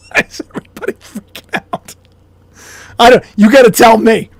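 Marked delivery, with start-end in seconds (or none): 0.1-1.9 high whispered aside. Real, not acted. Repeating his question to viewers in a whisper. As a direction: breathe it as a whispered joke.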